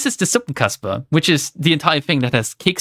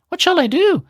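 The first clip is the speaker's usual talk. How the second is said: high, fretful plea; a panicky little girl asking for help; high flustered cry; 12 semitones higher than usual. A narrator's reading. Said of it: "she cried she".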